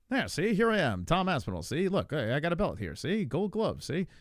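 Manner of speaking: high, swooping fifties broadcaster voice; Tom Aspinall. Acted. Streamer practising a fighter's voice, high old-time broadcaster impression. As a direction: ham up an old-time newsreel talker. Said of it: "Tom's got that w-, voice".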